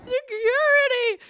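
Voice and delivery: high, very loud, drawn-out holler; screech-like imitation of an outraged caller; mock call for security. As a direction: yell it out long and high. Imitates woman calling for security.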